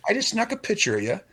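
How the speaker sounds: high, sly mock voice; a hint of cheeky teasing; sneaky waiter. Waiter teasing a couple about a photo, sly. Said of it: "it's like".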